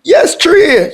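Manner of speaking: real heated retort, pitched high. Real, not acted. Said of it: "Why your voice raising?".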